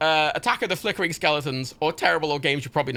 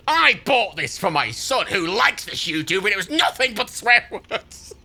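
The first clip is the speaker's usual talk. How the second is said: slow, put-on outraged mum voice; hammed-up indignant mother; mock annoyed mother; 7 semitones higher, 4 dB louder, slower than usual. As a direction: read it like a huffy parent complaining. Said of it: "one one-star review going".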